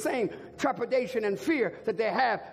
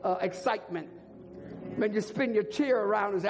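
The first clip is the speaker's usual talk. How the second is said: ordinary talk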